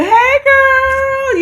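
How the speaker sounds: put-on high, loud warm hey girl; real greeting. [name] greeting her friend Tarika for real, high and loud. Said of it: "the real greeting".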